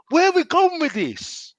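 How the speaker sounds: high, drawn-out exasperated question; fuming. Real, not acted. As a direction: wail it in real frustration, pitch right up.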